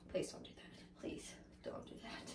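barely audible, voiceless plea; whispered aside. Real, not acted. Vlogger retracting her drinking-game joke to viewers, whispered aside. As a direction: drop to a hushed whisper.

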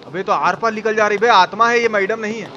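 normal talking voice

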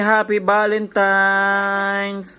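put-on drawn-out, slow model line for a toddler; slow prompt for a child. Shown as a demonstration. Father prompts his child to greet Mommy, slow and drawn out. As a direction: spell it out slowly so the kid copies. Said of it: "Say to Mommy".

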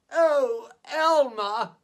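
put-on an old elephant gasps at the joke; gasping old elephant. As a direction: catch your breath, then the name. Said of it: "gasped an old elephant".

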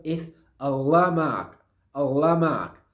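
Allah ma'ak said slowly, Allah stressed; slow model. Shown as a demonstration. Teacher modeling Allah ma'ak for learners, slow. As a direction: lean on Allah, then ma'ak. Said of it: "Otra forma que se usa mucho".

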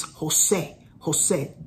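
Jose said as ho-SAY with a Spanish h; Jose, h sound first, stress on the end; Spanish pronunciation. Shown as a demonstration. Showing viewers how Jose sounds in Spanish. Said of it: "In Spanish version is".

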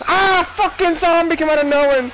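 high, frantic yelling; startled screaming. Real, not acted. Player jumped by a zombie, startled screaming. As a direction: cry out at a sudden attack.

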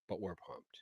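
hushed excited whisper. Acted. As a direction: breathe it out softly. Whispered aside about hype.

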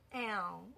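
"L" held long with a nasal ending; nasal vowel. Shown as a demonstration. Teacher pointing out the same tailed letter, held nasal vowel. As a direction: draw out each "L" separately. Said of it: "就是前面这个L".

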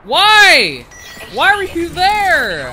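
loud, high-pitched cry of exasperation; frustrated yell. Real, not acted. Yells at player who killed him.

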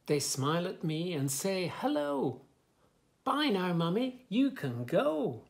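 lively, sing-song story reading; reading to children. Acted. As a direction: bounce the rhymes for little listeners. Reads rhyming picture book aloud.

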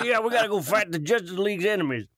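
fast, high trucker hero voice; lightly voiced trucker Aquaman; Southern trucker Aquaman. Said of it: "the voice of a, of a, a Southern trucker".

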